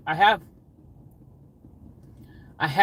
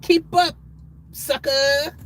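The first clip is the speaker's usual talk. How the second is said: gloating taunt; 12 semitones higher, much slower than usual. Pretend. Driver gloating at the cars he passes, high mock taunt. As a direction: sing out a cheeky goodbye as you overtake.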